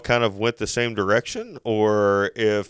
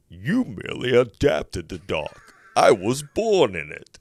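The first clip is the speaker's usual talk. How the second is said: Bane impression. Dad quoting a movie to his son afraid of the dark, Bane impression. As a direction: do an amateur impression of a masked film villain. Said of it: "Let me see if I can do this".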